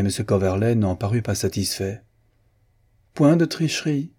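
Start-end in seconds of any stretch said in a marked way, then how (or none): 3.1-4.1 sharp exclamation. Acted. Coverley accusing Lord Merton of cheating, indignant cry. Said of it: "s'écria-t-il".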